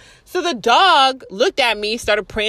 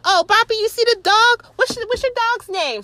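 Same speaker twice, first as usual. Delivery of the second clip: higher, sweet voice talking to her little dog; lady's high voice; 12 semitones higher than usual. Retold or imitated. Lady at the neighbor's yard cooing to her small dog, high-pitched. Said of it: "So then she was like".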